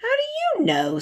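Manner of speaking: high, curt challenge without pity; unsympathetic. A narrator's reading. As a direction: throw the question back coldly. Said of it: "said Mary unsympathetically".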